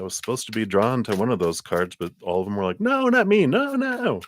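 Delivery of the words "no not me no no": much higher, cartoonish little no; squeaky refusal. Cards refusing the reader, high squeaky no. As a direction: play the cards backing away.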